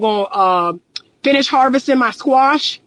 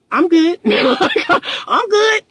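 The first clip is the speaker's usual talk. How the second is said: laughing refusal; 7 semitones higher than usual. Real, not acted. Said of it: "laughing refusal".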